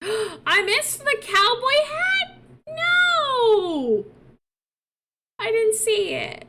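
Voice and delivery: gasp, then high drawn-out wail; dismayed disbelief. Real, not acted. Gamer learns she missed cowboy hat, dismayed. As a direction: cry out in high-pitched regret.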